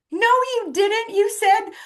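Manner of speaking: loud, very high indignant rebuttal; retold upset. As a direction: act out an exasperated, drawn-out denial. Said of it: "He was mad. He was upset".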